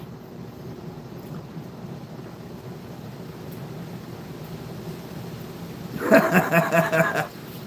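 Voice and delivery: long burst of rapid laughter; real laughter. Real, not acted. Livestreamer amused at his own remark, real laughter. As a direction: laugh it out in a quick run.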